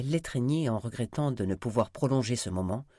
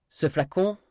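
raised, forceful threat; threatening warning; 8 semitones higher, 6 dB louder than usual. Acted. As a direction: warn them sharply, louder and higher. Threat with virus vial.

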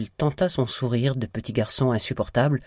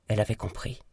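usual speaking voice

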